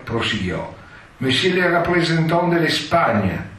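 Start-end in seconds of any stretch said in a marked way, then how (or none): 1.2-3.5 high, booming formal announcement; stentorian usher. Retold or imitated. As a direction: call the envoy in like a palace usher. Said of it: "con voce stentorea andava annunciando". (annuncio stentoreo da usciere)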